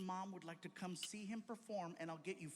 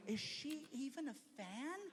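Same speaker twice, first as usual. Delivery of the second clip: doubtful question; 8 semitones higher than usual. Retold or imitated. Emily doubting the mom.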